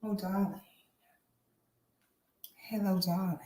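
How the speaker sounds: soft sultry "darling" greeting; breathy vintage purr. Acted. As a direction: purr it like an old-Hollywood diva. Campy greeting persona.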